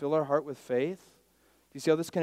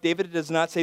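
ordinary talk

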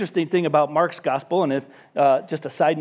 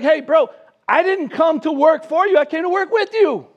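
much higher, louder, fed-up protest; indignant retort; 8 semitones higher, 4 dB louder than usual. Retold or imitated. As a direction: set the lazy friend straight. Annoyed helper retorts to friend.

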